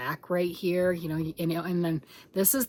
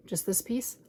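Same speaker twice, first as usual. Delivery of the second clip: whispered aside; 7 dB quieter than usual. Real, not acted. Showing finished piece.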